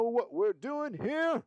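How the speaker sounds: high, slow country drawl; a sudden drawling country accent. Pretend. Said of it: "I just had a country accent".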